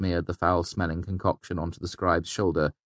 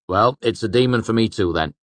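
sharp; 5 semitones higher, 6 dB louder than usual. A narrator's reading. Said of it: "Chronicler said sharply".

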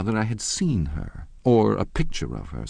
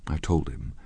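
usual speaking voice

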